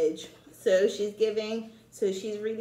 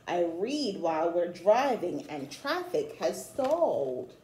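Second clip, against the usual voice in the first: rhythmic read-aloud of a rhyming picture book; storybook reading. Acted. Teacher reading a rhyming book to children, read-aloud style. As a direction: read the rhymes to children with a gentle lilt.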